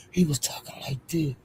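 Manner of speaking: very soft, small mock voice; imitating subdued talk. Showing viewers how he talked afterwards, hushed imitation. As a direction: drop right down to mimic him meekly. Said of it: "He wasn't talking all loud".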